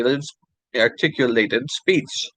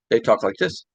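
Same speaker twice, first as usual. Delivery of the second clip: "talk like this" slurred into one quick string, no gaps; words run together; 2 semitones lower than usual. Shown as a demonstration. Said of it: "with no separation really between them".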